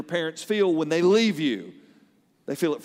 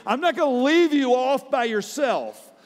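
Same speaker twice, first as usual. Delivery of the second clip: fervent preaching; 7 semitones higher than usual. Acted. Preaching Jesus' promise with fervor.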